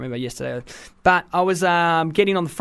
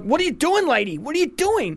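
much higher mocking rude-guy voice; rude bus guy; 9 semitones higher than usual. Mimicking a queue-jumper shoving past a mother with a pram. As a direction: sneer it up high like a pushy jerk. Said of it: "this dude's like".

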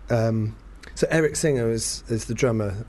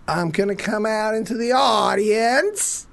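much higher, slow, hammed-up rock-star stage voice; mock the frontman coaxing the crowd, pitched way up; 9 semitones higher, slower than usual. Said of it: "He's like going".

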